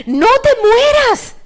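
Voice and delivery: high, loud, drawn-out plea; desperate cry. Acted. Peter begging Jesus not to die, desperate cry. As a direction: cry it out slowly, begging him.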